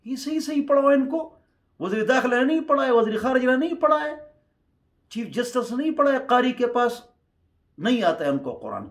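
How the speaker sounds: high, loud rebuke. Real, not acted. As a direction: rail at the teachers loudly. Scolding rising tirade. (بلند آواز میں ڈانٹ)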